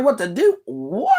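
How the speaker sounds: hammed-up indignant "What?"; mock outrage. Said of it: "talking to us like".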